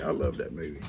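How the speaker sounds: ordinary delivery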